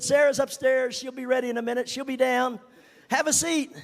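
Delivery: excited hostess opening the door; cheerful greeting. Retold or imitated. Sister Branham greets at door.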